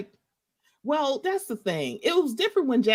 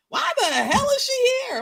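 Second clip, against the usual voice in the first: mock outrage, pitched much higher; indignant disbelief. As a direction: squeal it in exaggerated disbelief. Outraged at cast member's return.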